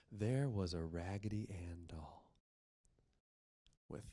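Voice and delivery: hushed storyteller whisper. A narrator's reading. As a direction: drop to a secret whisper.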